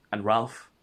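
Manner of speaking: ordinary delivery, nothing marked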